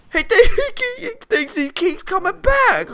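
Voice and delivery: very high, squeaky laughing voice; laughing incredulously. Real, not acted. Player laughs at opponent who keeps coming back, incredulous. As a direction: crack up mid-sentence, pitch shooting up.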